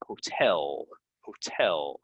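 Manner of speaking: "hotel" said ho-TEL, last syllable drawn out; second-syllable stress. Shown as a demonstration. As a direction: lean slowly on TEL in "hotel". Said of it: "So the stress is on".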